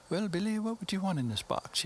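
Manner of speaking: put-on high, prim English accent; fake English accent. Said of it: "my fake English accent".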